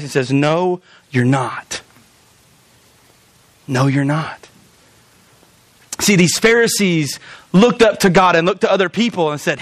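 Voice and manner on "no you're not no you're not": low, slow, stern correction. Acted. God answering a boast of being good, stern. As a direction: say it straight and low, unhurried. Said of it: "in a very straight, stern face".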